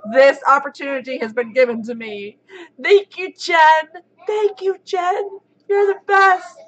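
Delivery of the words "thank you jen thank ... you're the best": high-pitched excited gratitude; giddy, high thank-you; gushing thanks. Real, not acted. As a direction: squeal your thanks, high and happy.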